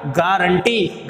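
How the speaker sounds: गारंटी drawn out slowly, ga-ran-ti; slow memory pronunciation. Shown as a demonstration. Teacher drilling the spelling, saying गारंटी slowly. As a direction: stretch गारंटी out for the class. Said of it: "गवार आंटी से आप क्या रखोगे याद".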